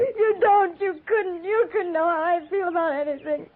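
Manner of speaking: high, crying protest; tearful outburst. Acted. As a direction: push the words out through sobs.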